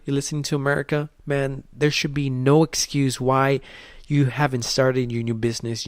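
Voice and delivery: ordinary delivery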